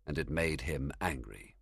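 ordinary delivery

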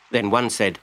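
plain reading voice

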